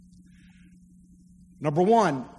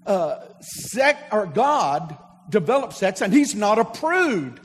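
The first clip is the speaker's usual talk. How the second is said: ordinary talk, nothing marked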